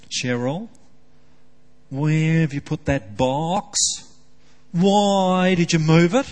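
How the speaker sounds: mock whining husband. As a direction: play up a grumbling whine at Cheryl. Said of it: "in a bit of a whining mood".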